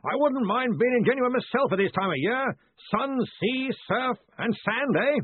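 high, blustering long-distance call; hearty yelling. A narrator's reading. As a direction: holler chummy small talk through cupped hands. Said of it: "cupping his hands to increase the volume".

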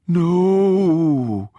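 high, loud, long-drawn wail; stretched ghostly moan; mock ghost voice. A narrator's reading. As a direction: play a hammed-up spook. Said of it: "the voice continued".

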